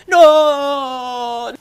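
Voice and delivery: high, long-drawn melodramatic shout; horrified mock scream. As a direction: howl it in overblown despair. [name]'s horrified cry, acted.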